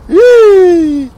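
high, loud, held squeal; long high "eee" of glee; gleeful squeal. Real, not acted. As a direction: squeal with delight at the nickname. Mock delight at his new nickname.

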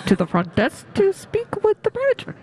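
mock front desk page; flat echoey PA page.

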